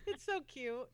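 usual speaking voice

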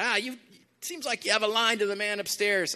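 half-hearted New Jersey guy impression; New Jersey accent. Imitating a New Jersey guy asking the preacher for a favour, in a light New Jersey accent. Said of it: "this guy from New Jersey do that".

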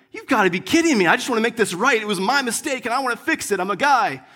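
retold frustration. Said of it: "but I was frustrated".

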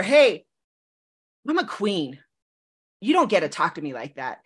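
half-joking royal scolding; sassy queen talk. Retold or imitated. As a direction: playfully put him in his place as a queen. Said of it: "that's how I talk to him sometimes".

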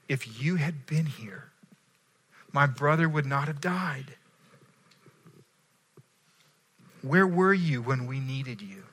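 soft, half-whispered grieving words; retold weeping plea. Mary at Jesus' feet, a gentle imitation of tearful pleading. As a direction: let it break quietly, as through sobs. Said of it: "Mary was weeping".

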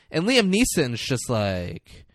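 ordinary delivery

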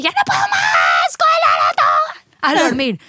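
high, slow, desperate yell; retold yell. Mother at her limit yells at husband, slow and desperate. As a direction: cry out at the end of your rope. Exhausted mom yelling at husband.

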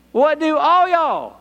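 high, slow emphasis on ALL Y'ALL; emphatic. Acted. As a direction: press the question onto ALL Y'ALL. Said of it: "in a very emphatic way".

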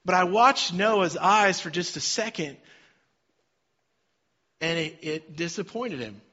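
ordinary talk

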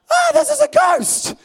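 very high, loud mock shriek; terrified shriek. Disciples to the figure on water, high mock shriek. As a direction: squeal like you've seen a ghost.

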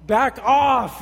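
loud, high and indignant; hammed-up teenage outburst; mock teen protest. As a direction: tell your mom off like an exasperated teen. Teen snapping at mom on phone.

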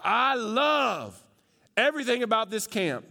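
put-on high, bubbly kid gushing over camp; gushing camper letter. Pastor reading a camper's note home, bright and gushing. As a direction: read it like a thrilled young camper. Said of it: "a note that one camper wrote to her mom".

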